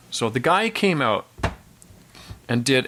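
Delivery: usual speaking voice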